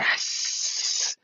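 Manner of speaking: long voiceless hiss; vampire hiss. Retold or imitated. Vampire rabbit knocked into shopping cart, long hiss.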